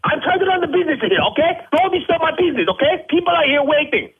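high, fast furious rant; angry rant. Acted. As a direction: yell that you'll call the police. Owner threatens police.

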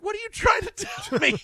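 high, mock-incredulous squeak; retelling his own baffled reply to a co-host, high and incredulous. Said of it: "I'm looking at him like".

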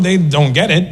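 normal talking voice

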